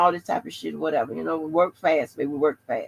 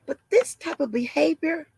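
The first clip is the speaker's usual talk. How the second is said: mock-alarmed office lady, pitched way up; 10 semitones higher than usual.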